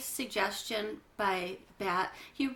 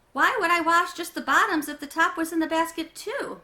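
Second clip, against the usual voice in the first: exasperated question; 7 semitones higher, 8 dB louder than usual. Acted. Mom asking back.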